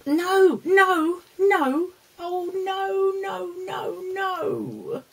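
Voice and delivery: high repeated pleading nos; exasperated no. Real, not acted. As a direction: beg the dog to stop. Dog eats everything.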